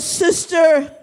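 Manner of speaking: high, slow, crying-out plea; impassioned retelling with a cry in it; tearful plea. Retold or imitated. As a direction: act a convert begging through tears. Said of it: "he began to cry".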